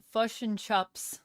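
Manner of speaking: slow kiwi vowels, fish as fush; New Zealand accent. Shown as a demonstration. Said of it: "For New Zealand".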